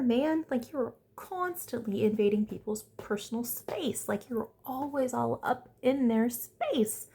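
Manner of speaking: worked-up fan ranting at the screen; exasperated rant. Real, not acted. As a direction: call out a hypocrite in disbelief. Ranting at a cast member.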